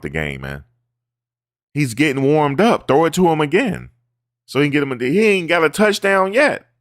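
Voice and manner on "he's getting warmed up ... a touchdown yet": much higher, louder fan outburst; excited yelling. Real, not acted. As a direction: shout it like a fan at the TV. Fan yelling about the game.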